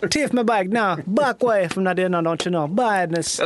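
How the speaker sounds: hammed-up Jamaican patois; Rasta voice. Joining the Rasta Mountie bit in mock Jamaican accent. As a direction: bark it like a Rasta cop on patrol. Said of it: "a fucking Rasta".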